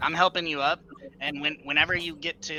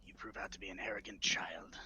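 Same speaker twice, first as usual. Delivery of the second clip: put-on softer, hissed rebuke to the boy; contemptuous whisper; 5 semitones lower, 8 dB quieter than usual. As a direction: scold him under your breath. Character scolds in whisper.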